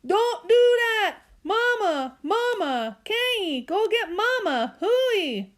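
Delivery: high, loud, muffled yelling; frantic boy. Acted. Byron's ear is tugged, he yells in panic. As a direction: scream for Mama with lips stuck. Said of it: "By went nuts again".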